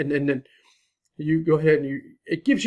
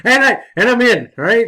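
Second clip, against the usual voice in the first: laughing, higher and louder; 7 semitones higher, 11 dB louder than usual. Real, not acted. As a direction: chuckle out the triumph of getting in. Laughs on getting logged in.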